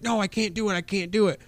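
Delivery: high, whiny no; panicked refusal. Retold or imitated. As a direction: squeal it like a frightened man. Fat guy refuses in panic.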